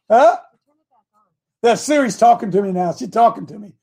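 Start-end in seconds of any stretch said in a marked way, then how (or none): 0.1-0.4 sharp startled huh. Real, not acted. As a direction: react as if the phone just spoke. Reacting to phone voice.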